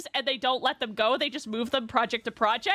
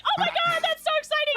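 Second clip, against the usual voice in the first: excited quote; 13 semitones higher than usual. Retold or imitated. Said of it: "But I was like".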